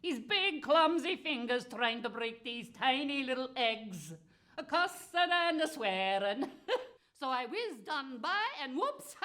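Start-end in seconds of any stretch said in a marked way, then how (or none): 4.6-9.2 giggling through the words; laughing. Real, not acted. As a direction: laugh as you tell it. Laughing at the giant.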